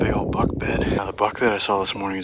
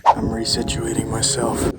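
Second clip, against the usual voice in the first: ordinary talk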